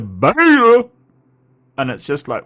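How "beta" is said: high, loud mock shout; Jesse Lee Peterson yelling. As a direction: bark it like the unhinged host. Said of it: "He, he's the one that".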